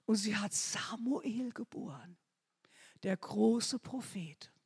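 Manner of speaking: sudden reverent whisper. Real, not acted. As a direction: lean in and breathe the words. Preacher drops into a whisper.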